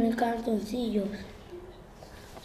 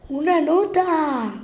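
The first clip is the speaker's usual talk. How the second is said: high, slow delighted cry; excited discovery; 5 semitones higher, slower than usual. Real, not acted. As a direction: point it out, thrilled. Spots a note, excited.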